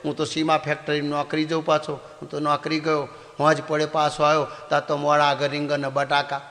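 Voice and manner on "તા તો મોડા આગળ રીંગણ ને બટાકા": ordinary delivery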